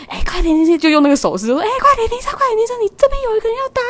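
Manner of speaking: high, loud urgent shouting; calling out. Retold or imitated. Taxi driver shouting at the bus to stop, loud and urgent. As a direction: yell to flag the bus down.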